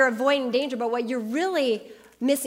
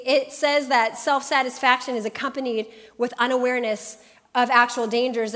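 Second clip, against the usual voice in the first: ordinary talk, nothing marked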